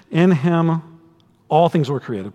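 "all" leaned on; reading that presses the word "all"; stress on all. Shown as a demonstration. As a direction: lean into "all" in "all things were created". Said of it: "I emphasized the word all".

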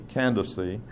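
Candace said softly as can-duh-see; English pronunciation. Shown as a demonstration. Teacher repeating the suggested pronunciation, Candace softly as can-duh-see. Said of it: "which suggests an English pron".

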